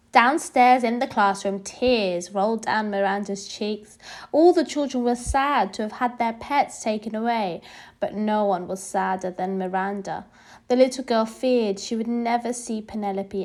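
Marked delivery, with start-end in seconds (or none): none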